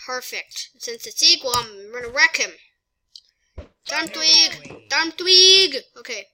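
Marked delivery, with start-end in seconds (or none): 3.9-5.8 raised, louder battle call. Pretend. Gamer sending out a Pokemon, loud summoning call. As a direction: call the name, building louder.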